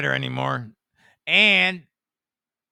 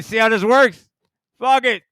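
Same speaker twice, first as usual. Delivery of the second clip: much louder and higher, defiant shout; loud outburst; 6 semitones higher, 12 dB louder than usual. Real, not acted. Streamer defying his mic muzzle, loud defiant shout. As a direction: blast it past the mic muzzle. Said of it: "what a muzzle on my mic".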